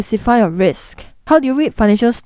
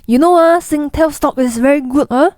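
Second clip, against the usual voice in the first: put-on taxi uncle Singaporean accent; 5 semitones higher than usual. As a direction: give a tip like a Singaporean cabbie. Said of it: "taxi uncle says".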